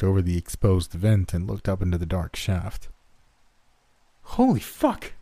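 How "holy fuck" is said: high-pitched scream without much volume; shocked, high yell, held back. A narrator's reading. Tanner as the monster jumps on him, high scream. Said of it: "Tanner screamed".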